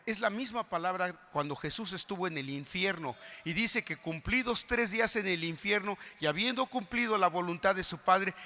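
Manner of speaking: usual speaking voice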